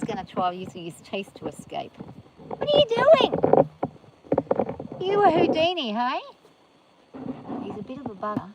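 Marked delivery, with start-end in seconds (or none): 2.5-6.2 much higher, loud mock-amazed cry; exclaiming at the horse. Real, not acted. Owner catches horse opening gate, high loud teasing cry. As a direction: catch him in the act, teasing.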